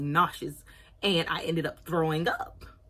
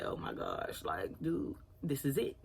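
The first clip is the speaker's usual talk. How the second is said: hushed, breathy dread; inner panic; 3 semitones lower, 9 dB quieter, slower than usual. Retold or imitated. In her head as mom checks her stomach, hushed dread. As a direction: mutter it like the game is up. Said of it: "freaking out inside my head".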